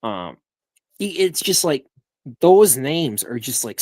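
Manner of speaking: ordinary delivery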